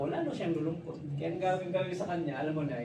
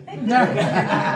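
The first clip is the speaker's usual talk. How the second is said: loud burst of laughter; 10 dB louder than usual. Real, not acted. Laughs at own nickname joke.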